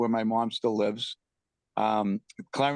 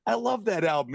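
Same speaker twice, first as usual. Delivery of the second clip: higher, enthusiastic retelling; re-enacted keen fan; 8 semitones higher than usual. Neighbour admiring the album from his deck, imitated as excited. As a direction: imitate an eager music fan.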